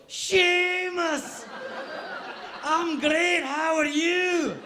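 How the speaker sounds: very high, loud, hammed-up Scottish shout; copied Scottish accent. Student helplessly mimicking his Scottish interviewer, exaggerated Scottish accent. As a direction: holler back in an over-the-top Scottish accent. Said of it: "I adopt the accent of wherever I am".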